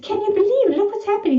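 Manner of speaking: high-pitched excited exclamation; retold amazement. Retells their delighted, laughing amazement.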